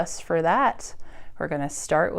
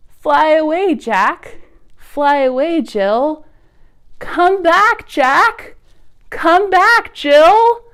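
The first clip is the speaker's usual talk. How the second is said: high, louder calling out; calling birds; 7 semitones higher, 5 dB louder, slower than usual. Acted. Librarian calls the blackbirds home, high call. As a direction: call the birds back from afar.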